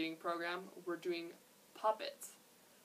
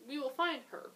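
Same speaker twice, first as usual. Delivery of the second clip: insisting; 6 semitones higher than usual. A narrator's reading. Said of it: "she insisted".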